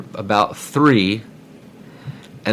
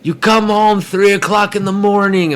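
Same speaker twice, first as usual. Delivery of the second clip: dad yelling; 9 semitones higher, 5 dB louder than usual. Retold or imitated. Dad yelling about the noise.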